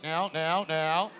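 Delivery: lightly mimicked halting politician; Barack. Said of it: "He's always stuttering".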